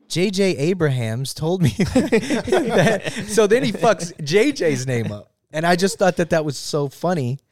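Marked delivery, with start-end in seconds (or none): 0.1-5.3 laughing. Retold or imitated. Quoting Roger, cracking up.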